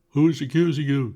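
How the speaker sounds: loud, high challenge from the crowd; shouted. Acted. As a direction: shout it back at Potter. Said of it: "shouted a voice".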